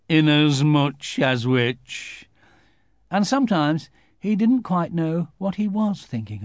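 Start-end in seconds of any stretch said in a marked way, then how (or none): 0.1-2.3 slow, drawn-out mournful Eeyore; gloomy donkey. A narrator's reading. Eeyore musing alone in his corner, slow and gloomy. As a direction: stretch it out, heavy and sad.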